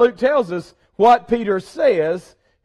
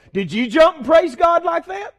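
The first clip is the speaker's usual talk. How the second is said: higher, pressing question; excited challenge; 10 semitones higher, 5 dB louder than usual. Real, not acted. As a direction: push the congregation, fired up. Challenging congregation.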